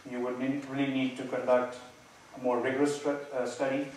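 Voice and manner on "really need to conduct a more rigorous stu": normal talking voice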